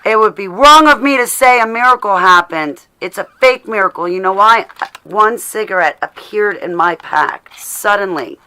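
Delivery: playful big-voiced astonishment; mock amazement. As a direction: announce it like a wonder, hamming it up. Hams up a cigarette miracle.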